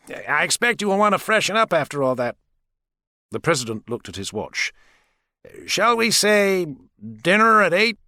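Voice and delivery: lightly theatrical presidential invitation; president's voice. Acted. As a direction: voice the president as a smooth, courteous host. President's voice, held figure.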